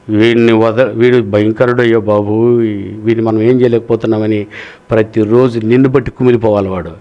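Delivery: a lightly voiced devil, tired and complaining; weary devil. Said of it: "అపవాది".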